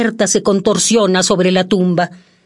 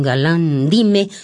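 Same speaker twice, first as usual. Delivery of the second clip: ordinary talk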